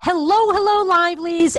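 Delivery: high, loud hello to fans; energetic greeting. Acted. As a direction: burst in with big host energy. Host's energetic opening greeting.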